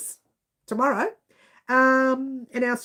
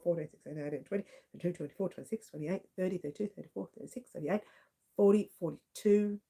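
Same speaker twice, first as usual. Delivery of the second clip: counting under breath; 5 semitones lower, 7 dB quieter than usual. Real, not acted. Counting rows under her breath.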